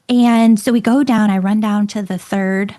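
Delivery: ordinary talk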